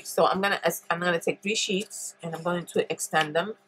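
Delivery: ordinary talk, nothing marked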